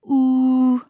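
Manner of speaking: "呜" held as one long, level oo; long vowel. Shown as a demonstration. Teacher isolating the vowel of pool, "呜" drawn out long. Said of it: "发长元音".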